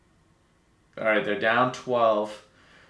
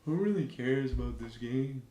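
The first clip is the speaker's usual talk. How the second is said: careless, shrugging player voice, a touch softer; lazily mock the players who don't care; mocking bored players; 5 dB quieter than usual. Streamer voicing the players answering their coach, careless shrug. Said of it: "this is probably them".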